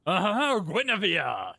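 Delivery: a mild hammed-up dreamy drawl on the name; fond mock of a car-obsessed brother; Barley's goofy voice. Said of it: "he goes from being".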